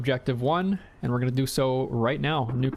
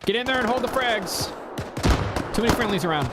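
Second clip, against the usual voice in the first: shouted order; 10 semitones higher than usual. Real, not acted.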